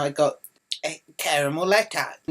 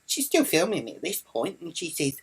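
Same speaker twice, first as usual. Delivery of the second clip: ordinary talk, nothing marked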